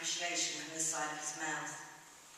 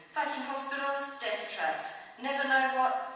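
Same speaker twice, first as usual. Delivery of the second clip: higher, forceful pub rant; indignant grumble; 7 semitones higher, 5 dB louder than usual. Acted. Drinker putting in about hospitals, indignant. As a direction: curse the hospitals with feeling.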